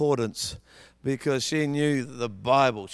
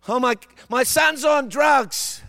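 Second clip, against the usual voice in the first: high, loud, slow wail of a worried parent; mock distraught parent; 10 semitones higher, 7 dB louder, slower than usual. A preacher voicing a frantic parent whose son is on drugs, high anguished wail. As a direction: cry it out like a panicked parent.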